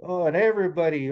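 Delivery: usual speaking voice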